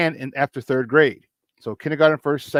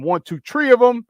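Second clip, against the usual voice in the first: high, louder mock Chicago accent; 9 semitones higher, 5 dB louder than usual. Host counting runs batted in, playful Chicago accent. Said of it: "In my Chicago accent".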